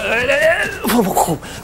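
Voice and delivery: rising high effort noise; high wordless strain, then release; straining bug noise. Acted. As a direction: imitate a bug pushing through a barrier. Bug straining through the barrier.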